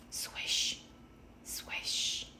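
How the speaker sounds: soft unvoiced swishing of leaves; whispered swish. A narrator's reading. Leaves landing on the roof, whispered swish. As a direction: breathe out the swish like leaves falling. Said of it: "The leaves fell on the roof".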